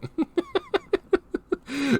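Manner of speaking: high, soft laughing fit; high helpless giggling. Real, not acted. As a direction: crack up laughing. Cracking up at his joke.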